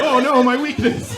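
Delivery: loud, high mock wail; defeated monster. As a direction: cry out like a villain hit in the weak spot.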